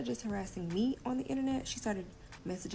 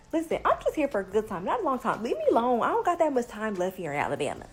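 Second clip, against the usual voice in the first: loud brush-off; 3 semitones higher, 8 dB louder, faster than usual. Retold or imitated. Telling off the pushy baby mama.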